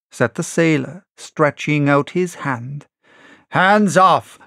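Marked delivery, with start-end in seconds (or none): 3.5-4.4 higher, louder warning cry; sharp cry. Acted. Silver warning Tom away, crying out. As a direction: snap it out, leaping back. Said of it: "cried Silver".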